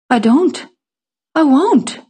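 put-on high, emphatic child's retort; defiant refusal. A narrator's reading. Child refusing to love the mother, high defiant retort. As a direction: pitch it up and refuse firmly.

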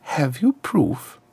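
slow, high-pitched challenge; unhurried high diplomatic voice; smooth ambassador. A narrator's reading. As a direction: ask it slowly, as a cool diplomat. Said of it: "said the ambassador".

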